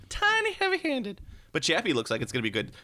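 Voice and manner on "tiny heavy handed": very high, slow squeak for tiny; squeaky tiny voice. Pretend. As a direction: shrink the voice way up on tiny. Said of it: "Just a li".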